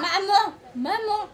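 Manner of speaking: calling out. Acted. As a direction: call out to stop her. Calming mother down.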